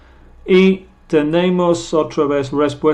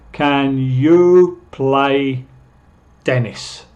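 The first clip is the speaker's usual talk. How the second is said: very slow, word by word, stretching "you"; drawn-out teaching example; slow model question; 5 dB louder, much slower than usual. Shown as a demonstration. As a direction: spell out the question slowly for learners.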